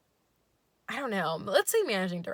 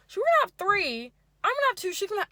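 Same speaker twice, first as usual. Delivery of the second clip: much higher, louder chirpy okay; high yay voice; 12 semitones higher, 8 dB louder, much faster than usual. Retold or imitated. Date agrees in a high goofy chirp. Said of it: "yay voice".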